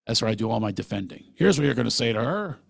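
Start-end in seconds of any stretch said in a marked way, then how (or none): 1.3-2.6 a raised, louder pep-talk voice; inner coaching voice. Retold or imitated. Talking to himself in the shower, a raised pep-talk voice. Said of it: "I talk to myself in the shower".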